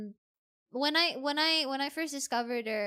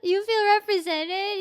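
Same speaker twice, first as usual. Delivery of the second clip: surprised; 7 semitones higher, 10 dB louder than usual. Real, not acted. Delighted reaction to chat comment.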